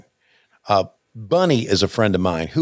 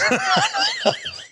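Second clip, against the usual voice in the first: laughs. Real, not acted. Laughs at own joke.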